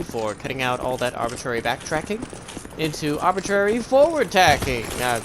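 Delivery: ordinary delivery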